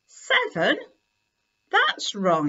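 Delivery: sudden lift on seven, puzzled; surprised. Acted. Kipper counting his toys, surprised. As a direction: catch the miscount with surprise. Kipper finds an extra toy.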